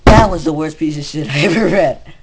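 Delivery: loud, real exasperated yell; real outburst after finishing the fic; disgusted shout. Real, not acted. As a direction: vent full disgust at the reader. Reacting to the awful fic.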